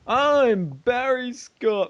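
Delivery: high, slow, booming ad shout; hard sell ad. Pretend. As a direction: belt it out like a TV pitchman. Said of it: "advertising voice".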